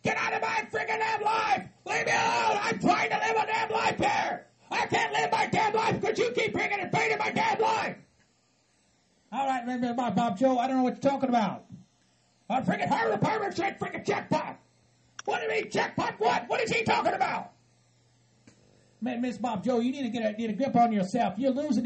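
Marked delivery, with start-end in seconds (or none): 0.0-8.0 furious hillbilly rant. Acted. 12.5-17.5 furious hillbilly rant. Acted.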